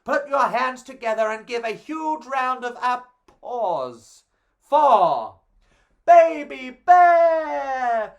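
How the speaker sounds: from loud invitation to a high, slow, shouted name; building to a shout. Acted. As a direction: whip the crowd up and yell the name. Goldilocks introduces Baby Bear.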